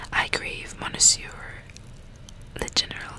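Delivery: normal talking voice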